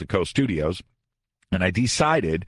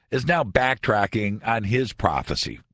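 usual speaking voice

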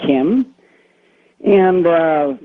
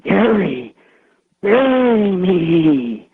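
slow eerie ghost voice; scary voice; 5 semitones higher, much slower than usual. Retold or imitated. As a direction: stretch it out like a ghost. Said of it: "really scary voice".

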